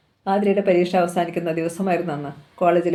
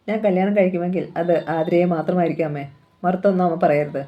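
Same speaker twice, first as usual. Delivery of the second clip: usual speaking voice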